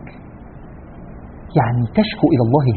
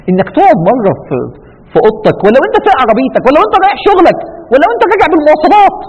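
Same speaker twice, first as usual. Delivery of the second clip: rising fervour; 11 semitones higher, 4 dB louder than usual. Real, not acted. Preacher urging the listeners, climbing in fervour. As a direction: pitch climbs higher and louder through the list.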